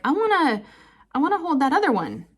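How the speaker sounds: a hint of a pleading daughter; eager child voice. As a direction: ask like an excited kid.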